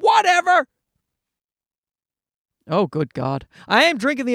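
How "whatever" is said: mock dismissive retort. To his wife about the zipper, a mock high dismissive whatever. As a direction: toss it back at the wife, lightly hammed. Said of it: "I said".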